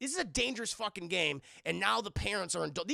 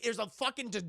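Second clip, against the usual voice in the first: ordinary delivery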